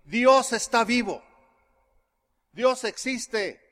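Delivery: aguda, lenta, con fuerza al arrancar; proclamación emocionada. Retold or imitated. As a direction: proclamarlo como quien acaba de descubrirlo, palabra por palabra. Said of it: "yo estaba llorando, y le dije". (anuncio exaltado, agudo y pausado)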